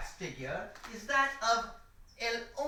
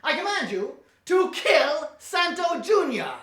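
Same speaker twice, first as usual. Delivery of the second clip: loud, higher shouted order; villainous command; 7 semitones higher, 8 dB louder than usual. Acted. Professor finally commanding the statues to kill the hero, shouting. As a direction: yell the kill order with full force.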